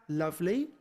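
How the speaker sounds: usual speaking voice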